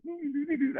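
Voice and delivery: high hum to herself, searching; high humming. Retold or imitated. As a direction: hum high and light while looking. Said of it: "she goes".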